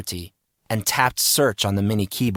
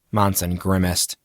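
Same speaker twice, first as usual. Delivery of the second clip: plain reading voice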